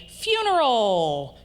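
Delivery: high, loud, drawn-out cheery call; happy tone. Shown as a demonstration. Lecturer demonstrates a sad word in a happy tone, happy. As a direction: sad word sung out gleefully, long falling glide. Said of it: "in a really happy tone of voice".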